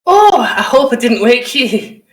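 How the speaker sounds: high, loud character voice; visiting taskmaster. Acted. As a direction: call it out as an acted visitor. Game master voices arriving visitor.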